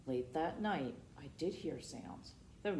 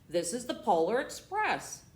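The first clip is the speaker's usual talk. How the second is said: louder, higher proud declaration; proud conductor; 7 semitones higher, 8 dB louder than usual. Acted. Conductor presenting train to boy, proud declaration.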